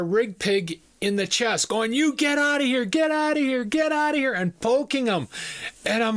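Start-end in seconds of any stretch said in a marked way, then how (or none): 1.9-4.3 high-pitched pestering yell; blustering little manager. Retold or imitated. Manager poking a big rig worker, blustering high-pitched yell. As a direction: rattle it off high, a small man acting tough.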